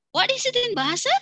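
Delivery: very high, lively question; teacher question. Shown as a demonstration. Teacher quizzing class in a very high voice. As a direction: quiz them playfully. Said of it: "teacher asks".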